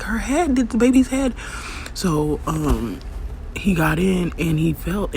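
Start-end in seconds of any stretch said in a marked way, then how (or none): 0.0-1.3 panicked news. Retold or imitated. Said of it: "She was like".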